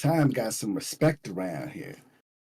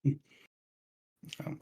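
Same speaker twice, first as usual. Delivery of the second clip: normal talking voice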